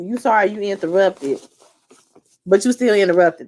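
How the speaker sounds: ordinary talk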